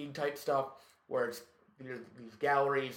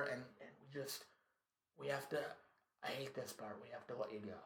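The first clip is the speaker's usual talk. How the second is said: much softer, halting bad news; soft reluctant firing; 14 dB quieter than usual. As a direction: murmur it like you hate saying it. Boss firing him, quiet.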